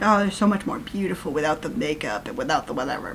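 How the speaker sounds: mocking men's voice. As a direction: drop your pitch to parody clueless men. Mimics men commenting on makeup.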